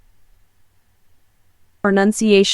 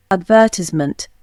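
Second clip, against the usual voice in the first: British accent "Advertisement" as ad-VER-tiss-ment, no R; British accent: stress VER in "Advertisement"; British accent demo. Shown as a demonstration. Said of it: "Pronunciation of particular words".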